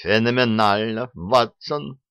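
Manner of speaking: plain reading voice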